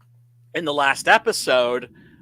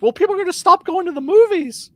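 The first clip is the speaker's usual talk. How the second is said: much higher, mock-pompous exec voice; mocking studio exec; 13 semitones higher, 4 dB louder than usual. Mocking the studio bosses, high mock exec voice. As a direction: sneer it out like a clueless studio boss.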